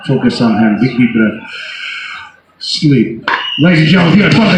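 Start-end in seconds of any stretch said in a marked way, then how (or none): 0.1-3.2 low, soft hypnotist voice; hypnotic induction. Acted. Hypnotist putting volunteers to sleep, low soft induction. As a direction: lull them down to sleep.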